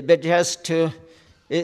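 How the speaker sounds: ordinary talk, nothing marked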